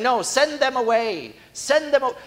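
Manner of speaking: put-on high, loud brush-off of the children; loud dismissal. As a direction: bark it out to shoo them off. Disciples turning children away.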